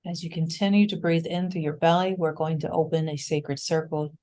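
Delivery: unhurried breath cues with long pauses; calm meditation leader, increasingly hushed; guided meditation. Real, not acted. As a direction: guide the group gently through each breath, softening as it settles.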